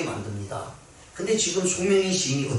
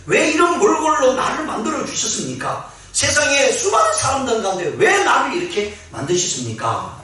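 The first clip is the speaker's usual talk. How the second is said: higher, louder pleading cry to God; retold anguished outcry; 9 semitones higher, 4 dB louder than usual. Poet crying out to God about her body, loud anguished plea. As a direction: cry out to God, begging why. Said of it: "하나님께 부르짖고 하나님께 눈물로 애원도".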